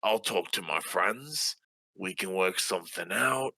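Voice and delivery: usual speaking voice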